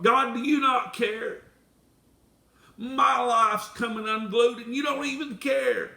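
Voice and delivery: high, loud hurt accusation; accusation against God. Acted. Believer accuses God of not caring, high and loud. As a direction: demand an answer from God. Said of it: "a sudden accusation against God".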